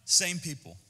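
normal talking voice

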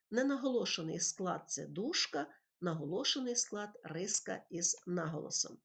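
normal talking voice

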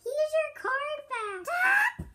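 high, slow teddy voice; slow squeaky teddy bear; high toy voice. Pretend. Plays teddy Peter.

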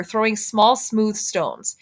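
ordinary delivery, nothing marked

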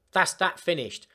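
ordinary delivery

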